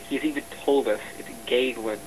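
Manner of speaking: normal talking voice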